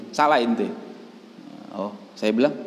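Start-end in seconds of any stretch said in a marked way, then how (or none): none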